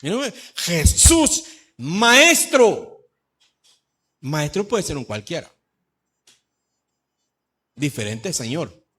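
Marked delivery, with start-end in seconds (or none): 0.5-3.1 loud, high drawn-out cry; shouted call. Acted. Ten lepers calling Jesús in chorus, shouting. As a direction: cry out to someone far away. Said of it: "en coro le cantaron".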